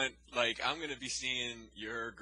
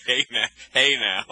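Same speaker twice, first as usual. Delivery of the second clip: loud; 3 semitones higher, 14 dB louder than usual. Real, not acted. Teasing call after a joke.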